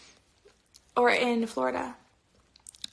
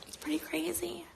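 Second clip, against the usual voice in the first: whispering; 5 semitones higher, 10 dB quieter than usual. Real, not acted. Teasing aside to livestream viewers.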